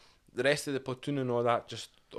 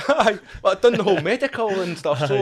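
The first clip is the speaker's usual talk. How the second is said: laughing through the words, higher and louder; laughing reply to a joke about a first podcast; 9 semitones higher, 9 dB louder than usual. Real, not acted. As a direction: chuckle while answering.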